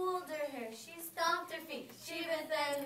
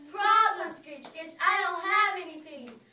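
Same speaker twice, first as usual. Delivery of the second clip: dismayed witch cry; 3 semitones higher, 9 dB louder than usual. Acted.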